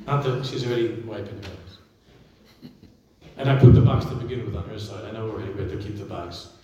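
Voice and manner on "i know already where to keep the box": normal talking voice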